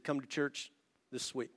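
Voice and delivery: soft invitation. Retold or imitated. Pastor softly inviting the weeping man to church, gentle and hushed. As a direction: coax him in tenderly, almost hushed.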